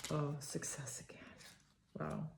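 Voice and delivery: hushed whisper of awe; awed whisper. Real, not acted. Reader seeing success again, awed whisper. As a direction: breathe it out in wonder.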